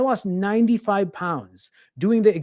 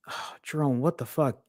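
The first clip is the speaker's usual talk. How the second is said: low, much softer self-reproach under the breath; muttering to himself; 5 semitones lower, 7 dB quieter than usual. Retold or imitated. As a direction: mutter it like scolding yourself alone. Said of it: "what I said to myself".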